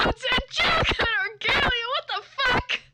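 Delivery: high, loud shocked yell; horrified shout. Real, not acted. Streamer yelling in horror at the fan fiction. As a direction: scream it out in disbelief.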